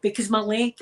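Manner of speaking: ordinary talk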